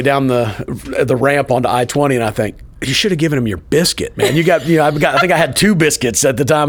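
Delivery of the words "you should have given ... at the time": self-scolding. Retold or imitated. Scolding himself over the biscuits.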